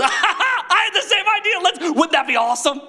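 high, giggly, excited agreement; Jesus laughing with God, high and gleeful. Retold or imitated. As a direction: laugh along, delighted at the idea.

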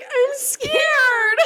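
high, tiny whimpered "I'm scared."; pathetic scared whimper. Retold or imitated. Imitating her own pathetic fright in the haunted house, high whimper. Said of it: "the way I say, I'm scared, I literally was like".

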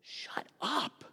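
harsh acted whisper; whispered rebuke. Peter pulls Jesus aside to silence him, whispered rebuke. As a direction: whisper it as a furtive scolding.

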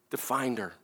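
ordinary delivery

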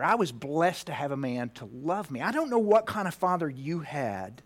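ordinary talk

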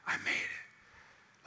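breathy, slow whispered relief. Retold or imitated. Dad at the NICU whispering relief to himself. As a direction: breathe it out, barely voiced. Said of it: "said it out loud".

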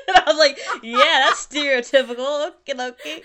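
loud, high, helpless laughter through the words; laughing. Real, not acted. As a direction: crack up at yourself while talking. Laughing at own Southern slip.

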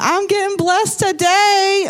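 high, drawn-out singsong crowing; mock jubilant boast. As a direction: imitate someone gleefully proclaiming a blessing. Mimics people boasting of blessing.

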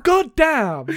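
high, loud swearing; exasperated outburst. Real, not acted. Co-host reacting to a Star Wars reference, loud exasperated outburst. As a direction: burst out in exasperation at the joke.

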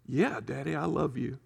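half-voiced, lightly playing the little daughter; soft child reply. Little girl softly answering her daddy, quietly.

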